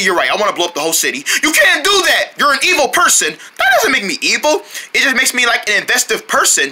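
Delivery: ordinary delivery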